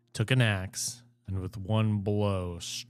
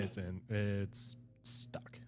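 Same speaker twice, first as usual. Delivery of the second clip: whispering; 11 dB quieter, slower than usual. Real, not acted. Quiet aside about anvil.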